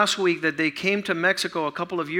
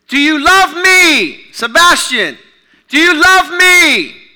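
full-force preaching; 6 semitones higher, 12 dB louder than usual. Acted. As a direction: proclaim each line like a call. Preaching Jesus' third question.

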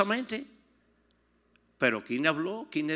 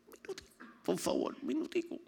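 retold whisper; 11 semitones higher, 5 dB quieter than usual. Asking for a minute, retold.

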